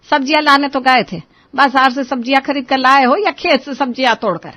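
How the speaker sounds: annoyed mother-in-law, loud and sharp; angry scolding. Acted. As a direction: scold them loudly for being late. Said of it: "आप इतना गुस्सा क्यों कर रही है".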